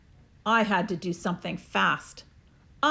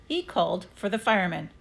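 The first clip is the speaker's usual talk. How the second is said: ordinary delivery, nothing marked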